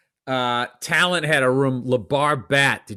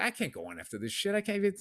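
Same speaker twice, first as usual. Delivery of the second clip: defeated mock mutter; 3 semitones higher, 12 dB quieter, faster than usual. Comic imagining walking out before his set, soft defeated mutter. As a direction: drop to a hushed, giving-up murmur.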